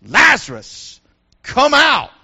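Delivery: loud, booming call; loudspeaker voice. Acted. Loudspeaker in paradise calling Lazarus, loud and commanding. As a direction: boom it out like a loudspeaker. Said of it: "it's like a loudspeaker in paradise".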